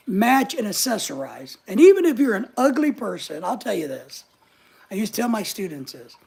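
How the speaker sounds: ordinary talk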